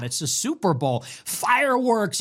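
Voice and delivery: ordinary talk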